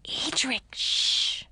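urgent unvoiced hiss; hissed whisper. A narrator's reading. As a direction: hiss a warning. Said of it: "I hissed at him".

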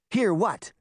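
high, irritated mild shout. A narrator's reading. Two quarrelling children answering Daphne, mildly shouted question. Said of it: "Pinocchio and Sabrina shouted".

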